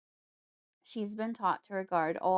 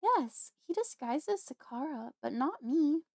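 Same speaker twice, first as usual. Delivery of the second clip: put-on upset young woman; 7 semitones higher than usual.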